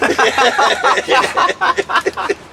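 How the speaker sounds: a long high burst of laughter; cracking up at the memory; loud high laughter. Real, not acted. Laughing at his own story.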